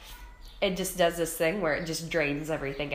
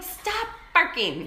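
scolding dog; 12 semitones higher, 6 dB louder, slower than usual. Retold or imitated.

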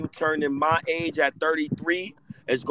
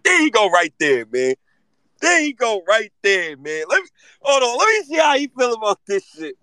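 higher and louder, eager; excited; 7 semitones higher, 4 dB louder than usual. Real, not acted. Host greeting his friend, excited and raised. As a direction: hype up the arrival.

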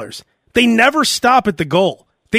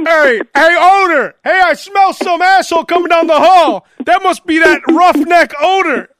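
loud, high mock-bully jeer; taunting bully; 9 semitones higher, 6 dB louder than usual. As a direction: holler it down the hall to tease him. Acting out bullies taunting Odor.

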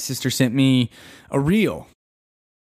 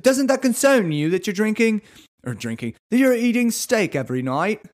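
high-pitched bad British accent, drifting Australian; British turning Australian; 8 semitones higher than usual. British guy questioning steak every night, accent sliding Australian. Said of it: "I think I got Australian there at the end".

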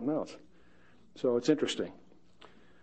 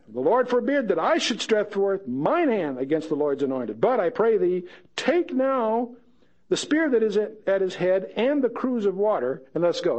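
a raised, ringing Bible read; scripture reading; 4 semitones higher than usual. Acted. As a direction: lift the verse up to the congregation. Reads scripture aloud.

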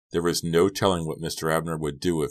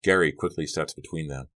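plain reading voice

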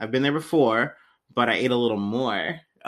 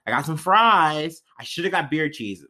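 ordinary talk

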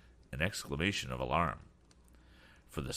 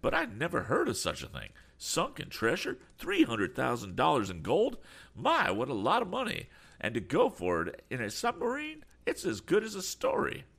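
high-pitched, excited eccentric gentleman; eccentric man, amazed; 11 semitones higher than usual. A narrator's reading. Eccentric man hearing of sunken treasure, amazed and excited. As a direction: marvel at it in swooping, raised pitch. Voices Mister Damon.

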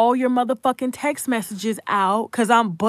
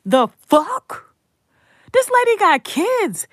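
outraged; 10 semitones higher, 4 dB louder, slower than usual. Real, not acted. Outraged rant peak.

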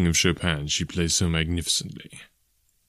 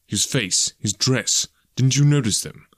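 pressing questions, building higher and louder to the end; impatient; 5 semitones higher, 3 dB louder than usual. A narrator's reading. Detective breaks in on the constable, impatient. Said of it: "Holmes broke in impatiently".

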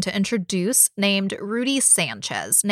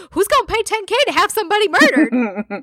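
higher, louder teasing parody of a friend; mocking imitation; 10 semitones higher, 4 dB louder than usual. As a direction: ham up the friend's naive question to rib her. Mocking co-host Jessica's earlier words.

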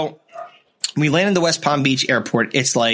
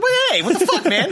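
very high, laughing goofy outburst; high zany Jerry Lewis squawk. Retold or imitated. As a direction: squeal it like a zany comic. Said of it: "Jerry Lewis like".

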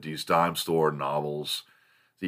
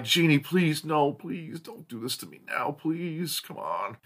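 pleading with the dog; 9 semitones higher, 7 dB quieter than usual. Retold or imitated. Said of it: "And I'm like".

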